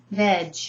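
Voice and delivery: "Veg" alone as a crisp vej; "Veg" as a separate teaching chunk; isolated syllable. Shown as a demonstration. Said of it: "I break it down completely".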